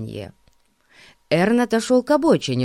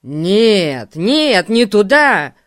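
louder, startled cry of protest; surprised exclamation; 7 dB louder, much slower than usual. A narrator's reading. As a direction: blurt it out in alarm. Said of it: "воскликнул удивлённый Эрн".

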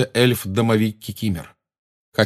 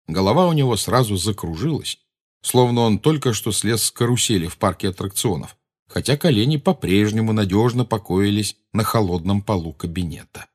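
ordinary delivery

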